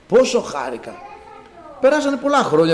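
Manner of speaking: normal talking voice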